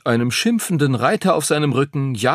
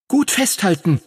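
sharp high command to hold on; commanding dragon; 10 semitones higher than usual. A narrator's reading. Dragon Phoenix ordering Henry mid-dive, high command. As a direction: bark the order from the air. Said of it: "befahl er".

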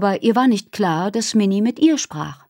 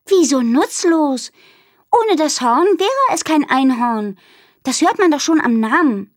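getting more and more irritated; 8 semitones higher, 4 dB louder than usual. Acted. Minnie correcting Aru about unicorns, irritated. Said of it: "Minnie klang immer gereizter".

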